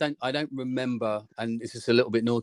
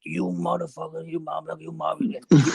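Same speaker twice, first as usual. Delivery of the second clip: high-pitched imitation of an irate father; mock tirade, strained and high; John Bainey; 6 semitones higher than usual. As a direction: curse repeatedly in a put-on high voice. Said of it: "got a voice like this".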